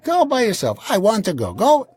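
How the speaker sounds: very high mock wife voice; imitating the wife. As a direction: toss it off like a fickle wife.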